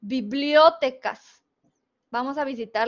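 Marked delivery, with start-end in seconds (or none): 0.0-1.4 "Bibliotecas" slow, BLIO loud and stressed as an esdrújula. Shown as a demonstration. Teacher testing an esdrújula version, stress on blio. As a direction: punch blio in "Bibliotecas", drop off on tecas. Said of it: "suponiendo que fuera esdrújula".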